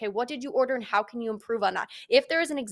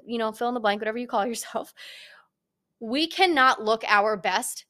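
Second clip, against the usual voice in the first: normal talking voice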